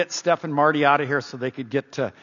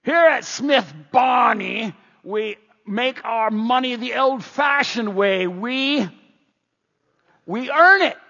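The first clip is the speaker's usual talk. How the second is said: loud, slow, higher pitched commercial announcer; Smith Barney ad voice; 5 semitones higher, 3 dB louder, slower than usual. Retold or imitated. Pastor imitating an old commercial for the congregation, booming. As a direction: boom it out like an old TV ad. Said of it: "There was an old commercial".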